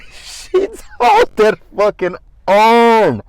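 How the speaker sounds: loud, high, slow, laughing; laughing hard while telling the story; laughing disbelief. Real, not acted. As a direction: shriek it through laughter. Laughing disbelief at urn toss.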